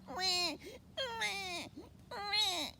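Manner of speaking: high mock newborn wail; baby crying.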